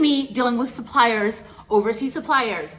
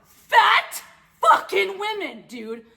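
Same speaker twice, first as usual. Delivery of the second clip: high, slow shouted disgust; stretched, scandalised yell of disbelief; 8 semitones higher, much slower than usual. Retold or imitated. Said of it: "And I was like".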